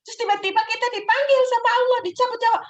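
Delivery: high, loud and fast, spilling out in alarm; playacting a sudden death, high and loud; dramatic alarm. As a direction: rush it out as if the worst just struck. Imagining dying mid-scolding.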